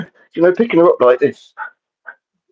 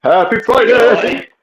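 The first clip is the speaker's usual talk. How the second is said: silly high voice; 10 semitones higher, much slower than usual. Pretend.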